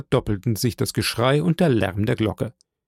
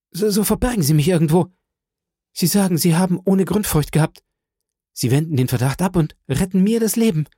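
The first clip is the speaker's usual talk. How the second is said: higher-pitched, hurried begging; urgent pleading; 6 semitones higher, faster than usual. Acted. Fugitive begs the women to hide him, urgent pleading. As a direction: beg desperately, in a rush.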